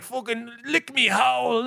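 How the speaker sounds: slow, put-on thick Dublin accent; mock Dublin accent. Des joking the Dublin accent is all he needs, slow thick Dublin accent. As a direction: drag out the Dublin accent slowly. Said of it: "be like".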